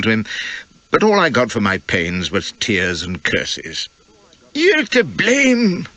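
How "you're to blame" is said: thin, high, drawn-out whimpering accusation. A narrator's reading. Grieving Morrison whimpers blame at Flashman, high and slow. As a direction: whine it slowly, weak and spiteful. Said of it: "whimpers he".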